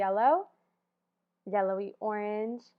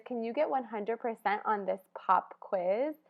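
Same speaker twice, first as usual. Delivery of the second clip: ordinary talk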